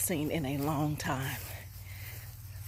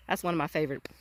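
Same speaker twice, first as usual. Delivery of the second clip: usual speaking voice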